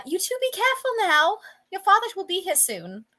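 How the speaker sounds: high-pitched English mother's voice; thick English accent. Acted. Mother warning the children at play, thick English accent. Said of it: "a very thick, uh, Eng-, well, E-, English accent".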